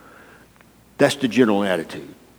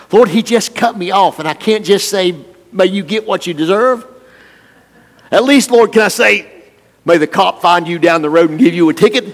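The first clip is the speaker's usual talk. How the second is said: high, loud, aggrieved whine to the Lord; hammed-up indignant prayer, pitched up; mock griping prayer; 7 semitones higher, 6 dB louder than usual. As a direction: play the wronged driver complaining to God, louder and higher. Acted griping prayer about a driver.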